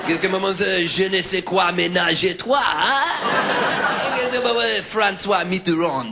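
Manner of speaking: French babble rising high on maman; mock French with a random French word; fake French gibberish. Stranger asking directions, mocked in a French accent. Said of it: "When I try and impersonate French".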